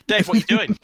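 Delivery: high, loud mock outrage; hammed-up loud telling-off; mock scolding. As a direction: call out Dave in pretend alarm. Acted scolding of noisy player.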